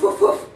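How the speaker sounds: loud high barking dogs; dog barks. Retold or imitated. Dogs chasing the hog in the bush, loud barks. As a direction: bark like hunting dogs.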